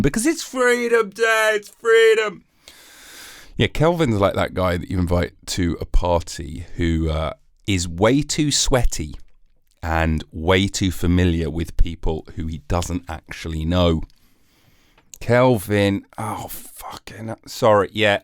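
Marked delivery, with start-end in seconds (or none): 0.0-2.4 hammed-up rejoicing over Freedom Day; mock jubilant. Mock celebration returns. 16.2-17.3 a sudden whispered swear; whispered aside, embarrassed; whispered curse. Real, not acted.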